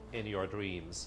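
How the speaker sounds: the doubting voice answering "In your dreams." under its breath; sceptical inner voice. Shown as a demonstration. Subconscious scoffing at the affirmation, low and soft. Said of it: "My subconscious is saying".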